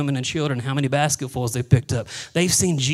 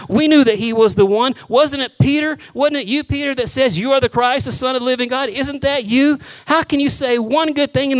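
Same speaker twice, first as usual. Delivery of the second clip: higher, louder incredulous rebuke; the disciples ribbing Peter for his blunder, higher and louder; exasperated scolding; 8 semitones higher, 5 dB louder than usual. Acted. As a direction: needle Peter in disbelief.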